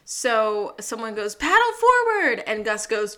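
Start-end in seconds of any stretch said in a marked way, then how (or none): 1.3-2.4 much higher, louder barked order; shouted command. Retold or imitated. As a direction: call the order over the roaring rapids. This pandemonium.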